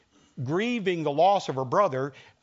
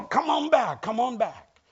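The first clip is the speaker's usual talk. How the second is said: pleading; 7 semitones higher than usual. Retold or imitated. Pleading to take back words.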